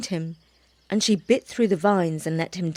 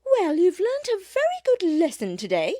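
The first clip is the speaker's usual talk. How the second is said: high mother cat voice; motherly cat; 11 semitones higher than usual. Acted. Mother bush cat giving her baby a lesson, motherly. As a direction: speak as a mother cat teaching her kitten.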